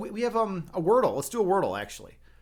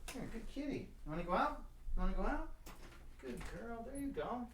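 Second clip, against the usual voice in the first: high, soft cooing to a cat; softly sweet-talking a pet; 8 semitones higher, 13 dB quieter than usual. Real, not acted. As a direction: coax the kitty gently, pitched way up.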